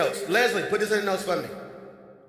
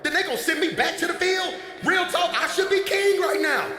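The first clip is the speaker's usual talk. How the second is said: ranting David; 7 semitones higher than usual. Retold or imitated. Pastor acts David insisting he's the one, indignant rant. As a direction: rant that you should be king.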